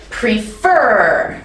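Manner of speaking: "prefer" with a long, strong FER; stress on second syllable. Shown as a demonstration. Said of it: "Emphasis is on the second syllable".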